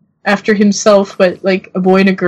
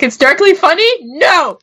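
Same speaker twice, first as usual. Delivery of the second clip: higher-pitched incredulous question, then a firm no; mock disbelief; 8 semitones higher than usual. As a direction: play an offended objector, jokingly. Imagining dropped-out co-host's objection.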